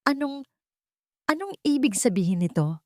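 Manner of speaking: shaky stammered question; stammering. Acted. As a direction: stumble over the words in shock. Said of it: "Nauutal na tanong ni Katarina".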